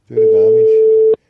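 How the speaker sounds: slow, high rallying shout; rally them with a shout; loud shout. Real, not acted. Urging viewers on.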